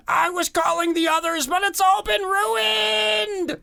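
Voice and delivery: very high, panicked shrieking wail; freaking out. Acted. Alien mother losing it in the street, panicked high shriek. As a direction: shriek it as it all falls apart. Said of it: "freaking out, continuing to say".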